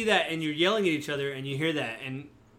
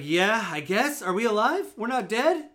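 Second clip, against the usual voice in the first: higher, louder, rising questions; confused; 6 semitones higher, 5 dB louder than usual. Acted. Game master voices a dazed survivor asking the player, confused. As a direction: climb in bewilderment with each question. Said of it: "he's all confused".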